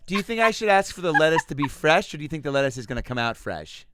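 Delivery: high, loud hammed-up mom voice; indecisive mom. Mom at restaurant asking her child, loud dithering. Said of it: "That sounds like my mom".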